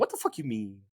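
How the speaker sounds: a hint of a West Coast gangbanger; Leroy voice. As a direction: swagger it like a street tough. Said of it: "can I hear the voice?".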